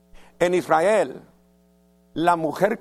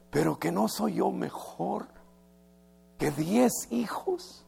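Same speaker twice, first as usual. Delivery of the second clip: soft tender plea; 2 semitones higher, 7 dB quieter than usual. Retold or imitated. Preacher voicing a husband comforting his wife, soft and tender. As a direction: murmur it lovingly. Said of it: "Mira, honey".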